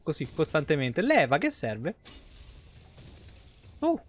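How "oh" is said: ordinary talk, nothing marked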